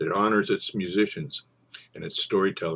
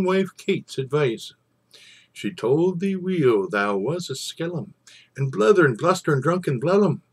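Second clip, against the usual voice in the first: higher, louder chiding of Tam; mock scolding; 8 semitones higher, 4 dB louder, slower than usual. Narrator chiding Tam in his wife's words, mock scolding. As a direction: wag a finger at the drunken fool.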